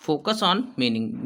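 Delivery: very slow, word by word; slow classroom dictation. Shown as a demonstration. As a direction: drag each word out for note-taking. Teacher dictating the point again.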